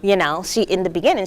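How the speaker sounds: ordinary delivery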